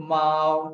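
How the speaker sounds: माओ drawn out and level; first tone. Shown as a demonstration. Teacher joining sounds into a syllable, level tone on माओ. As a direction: glide through माओ on one steady note. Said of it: "first tone".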